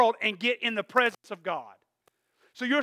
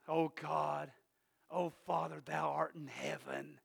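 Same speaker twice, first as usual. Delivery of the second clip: low, hushed pious prayer in put-on British accent; mock British accent; 7 semitones lower, 16 dB quieter, slower than usual. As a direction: pray stiffly in an Old English accent, soft and low. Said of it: "Could you cut the accent?".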